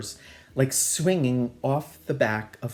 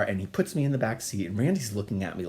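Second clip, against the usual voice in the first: usual speaking voice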